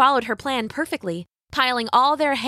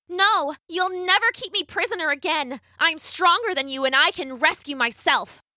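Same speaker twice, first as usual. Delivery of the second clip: put-on higher-pitched, resolute girl's voice; defiant Rapunzel voice, raised in pitch; defiant young heroine; 7 semitones higher than usual. A narrator's reading. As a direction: stand up to your captor. Rapunzel defies the witch.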